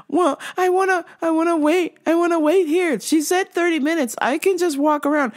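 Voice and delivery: high, whiny-insistent customer imitation. Customer insisting to husband on waiting, high and insistent.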